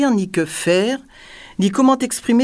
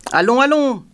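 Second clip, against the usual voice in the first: raised, impatient urging; 6 semitones higher, 4 dB louder than usual. A narrator's reading. King pressing his jester for ideas, impatient. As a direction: hurry him along with rising impatience. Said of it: "dit le roi impatienté".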